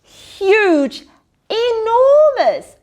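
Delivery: huge then enormous, rising high and slow; growing excitement. A narrator's reading. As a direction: stretch it bigger each word. Building size words, story read aloud.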